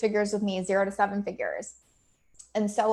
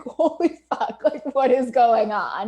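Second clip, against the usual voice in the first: imitating a shocked friend, higher and louder, half laughing; 4 semitones higher, 7 dB louder, slower than usual. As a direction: retell the friend's stunned reaction with a laugh. Best friend reacts to her sobbing.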